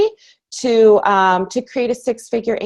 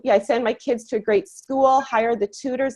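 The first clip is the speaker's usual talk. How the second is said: ordinary talk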